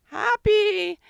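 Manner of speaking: a high, loud cry of happy; excited. Acted. Drawing a happy bird, squealing happy high and loud. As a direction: burst out with joy on the word. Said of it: "that's how I draw a happy".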